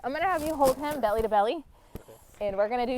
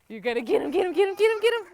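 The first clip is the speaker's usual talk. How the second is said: giggly tickle attack; 6 semitones higher, 7 dB louder, faster than usual. Real, not acted. Cheering kids on, tickling.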